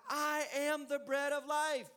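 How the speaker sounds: slow solemn scripture voice; solemn proclamation. Acted. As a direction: proclaim it as Jesus. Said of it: "Jesus says".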